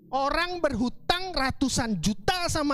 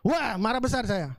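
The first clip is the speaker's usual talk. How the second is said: ordinary talk, nothing marked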